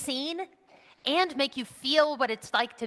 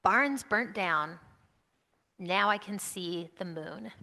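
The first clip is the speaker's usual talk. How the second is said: slow poem reading; 3 semitones lower, 4 dB quieter, slower than usual. Shown as a demonstration. Reads her favorite haiku.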